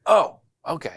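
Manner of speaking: high, loud softening "oh"; retold surprise. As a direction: imitate a man suddenly won over. Angry man learns he's Republican too.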